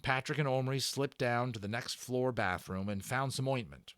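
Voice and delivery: plain reading voice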